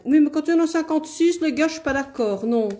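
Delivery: fast, high, loud playful protest; mock protest. Real, not acted. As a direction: object loudly and quickly, half joking. Protesting the card count to viewers.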